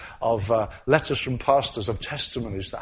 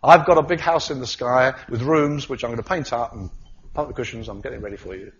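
Jesus to Peter; 9 dB louder than usual. Retold or imitated. Jesus mock-reassuring Peter about heaven, hammed-up and loud. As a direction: boom it like a cheerful host promising a room. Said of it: "So he's saying to Peter".

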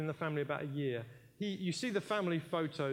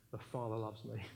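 tender; 7 semitones lower, 7 dB quieter than usual. Acted. Tender revelation repeated.

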